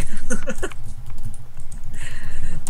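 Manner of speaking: loud breathy laughter. Real, not acted. Laughs after the spam joke.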